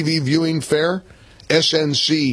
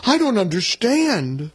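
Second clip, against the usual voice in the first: higher, drawn-out mocking quote; mock dismay; 4 semitones higher than usual. Host mocking Susan Collins's reaction, mock dismay. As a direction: mimic a baffled complaint, stretched out.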